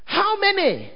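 high mock call of how many; retold shout. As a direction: call it out like the diver. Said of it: "Then he shouted".

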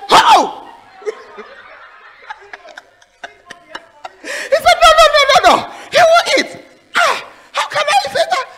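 very high, loud laughing cry; hammed-up shocked guest, high and laughing; laughing mock protest. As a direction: squeal the man's protest through laughter. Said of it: "And the man said".

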